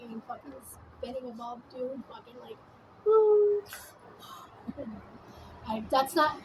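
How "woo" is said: high, loud "woo" sound; sustained high-pitched woo; imitated whoosh. Recalling getting high with a friend, high loud woo.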